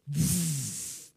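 loud imitated whoosh of a stampede; rushing whoosh. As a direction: make the noise of people racing past.

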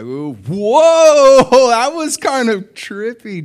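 long, loud, high whoa, easing off; hammed-up freaked-out wonder; startled amazement. Someone whose hug went through Jesus, startled and awed. Mimics hugging a hologram, startled.

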